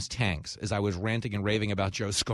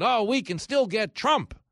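mock wishful; 11 semitones higher, 5 dB louder, slower than usual. Mocking Joe Scarborough's fantasy.

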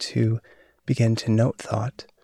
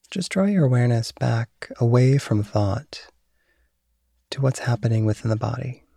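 guided meditation. Acted. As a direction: calmly guide awareness of thought. Leads a mindfulness meditation.

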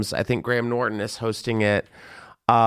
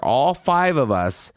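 normal talking voice

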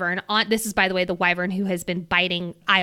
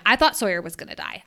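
ordinary delivery

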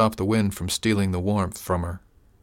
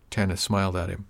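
plain reading voice

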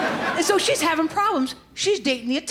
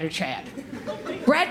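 normal talking voice

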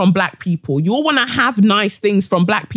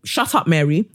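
ordinary talk

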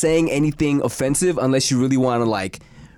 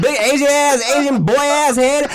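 high, heated mock roast; 8 semitones higher, 4 dB louder than usual. Roasting a friend about his parents, hammed-up aggression. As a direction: hurl it like an over-the-top insult comic. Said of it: "you say anything with the right energy".

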